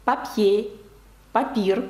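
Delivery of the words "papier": "Papier" stressed on -pier, French "pa-PYÉ"; final-syllable stress. Shown as a demonstration. Teacher illustrating French stress, final syllable of Papier. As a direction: put the weight on the end of "Papier". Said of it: "на останній склад падає наголос".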